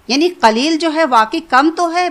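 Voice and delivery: normal talking voice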